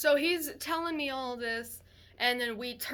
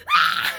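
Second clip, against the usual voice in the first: a piercing, much higher and louder mock baby wail; imitating a baby's scream; 12 dB louder than usual. Baby waking suddenly, imitated high loud scream. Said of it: "starts screaming".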